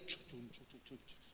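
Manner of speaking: soft, breathy chu chu chu sounds; imitated chu noise. Pastor imitating Elías grumbling after hanging up, a soft chu chu noise. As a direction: mimic the sulky muttering noise.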